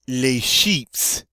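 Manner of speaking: "sheeps" ending in a clear PS; French "Les sheeps" with a hissed final S; final S pronounced. Shown as a demonstration. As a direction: sound the S at the end of "sheeps". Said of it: "supposed to pronounce the S on this one".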